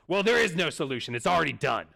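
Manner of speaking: put-on loud, stubborn old man; angry old man. Old bowler shutting down the clerk, loud and stubborn. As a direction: slam the door on any fix. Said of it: "he starts yelling".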